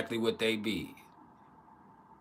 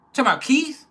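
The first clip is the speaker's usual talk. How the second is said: very high, loud questioning squeal; imitated girlfriend; 10 dB louder than usual. Girlfriend asked about a guy at her job, imitated high voice. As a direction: pitch it way up, rising on the name.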